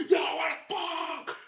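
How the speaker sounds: slow-stretched, held-back yell; strained retold scream; mock scream. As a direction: imitate screaming at the wheel, words drawn out. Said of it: "I was just screaming like".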